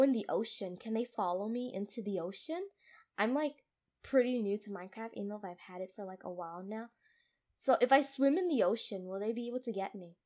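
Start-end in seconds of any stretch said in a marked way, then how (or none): none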